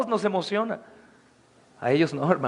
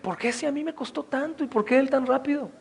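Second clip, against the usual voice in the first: softer, resentful mock whine; resentful complaint; 4 semitones higher, 7 dB quieter than usual. Older church member resenting a new believer, softly whined. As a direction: mutter it like a jealous old member. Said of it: "dirán".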